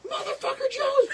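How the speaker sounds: high mock scream, laughing; imitated scream. Retelling her screamed music request to a fake Alexa, high mock scream. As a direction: mimic her yelling at the speaker. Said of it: "So she was screaming".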